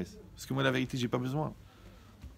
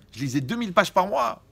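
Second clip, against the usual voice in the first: ordinary talk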